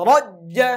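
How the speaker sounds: রজ্জা with a rolled, tapped র and a high rise; trilled r demo. Shown as a demonstration. Teacher drills রজ্জা with a rolled র, high. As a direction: tap the র in রজ্জা hard. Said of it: "টাস, টাস বাড়ি দিতে হবে".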